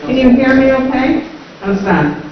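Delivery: garbled behind a face mask; speaking through mask, slightly garbled; muffled by mask. Real, not acted. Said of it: "it's a little garbled".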